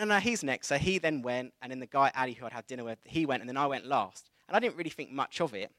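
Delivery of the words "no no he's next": insistent. Retold or imitated. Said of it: "and I went".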